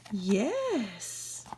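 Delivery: plain reading voice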